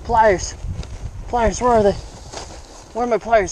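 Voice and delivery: flustered excited rush; frantic. Real, not acted. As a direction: scramble for the pliers in a hurry. Frantic hunt for pliers.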